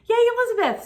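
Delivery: high, bright cheer for the winner; excited cheer. Real, not acted. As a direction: cheer the winner's name happily.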